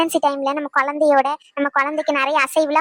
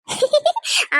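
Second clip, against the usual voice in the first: laugh; 11 semitones higher than usual. Acted. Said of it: "இப்படி சிரிச்சுக்கிட்டே பேசுவாங்க".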